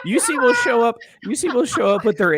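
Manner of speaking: high, loud, laughing yell; loud heckling shout; shouting rant. Real, not acted.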